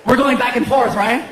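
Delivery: usual speaking voice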